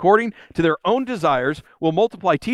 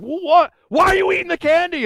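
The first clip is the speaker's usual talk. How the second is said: put-on high, louder bewildered teen voice; confused teens; 10 semitones higher, 3 dB louder than usual. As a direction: play the kids caught off guard. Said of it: "And they're like".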